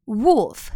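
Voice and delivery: wolf said with a short u vowel, like "wulf". Shown as a demonstration. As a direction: stretch wolf slowly with a short u sound. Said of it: "O发的是短音U".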